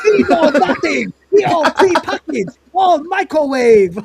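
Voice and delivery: put-on loud, high caricatured Chinese accent. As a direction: shout like a hammed-up Chinese-accented street seller. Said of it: "stereotype of a Chinese vendor".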